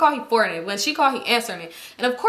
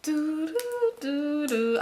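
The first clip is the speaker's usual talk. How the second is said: soft, held little tones; awkward doo-doo filler; 4 semitones higher, 7 dB quieter, much slower than usual. Retold or imitated. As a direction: fill an awkward silence with quiet doo-doos. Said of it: "it got awkward".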